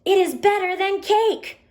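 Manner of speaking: high, punchy, excited exclamation; exaggerated excited feeling. Shown as a demonstration. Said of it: "say it with some feeling".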